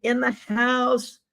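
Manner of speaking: high, slow sing-song greeting; slow, delighted welcome to a viewer; drawn-out welcome. Real, not acted. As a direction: stretch out the welcome joyfully.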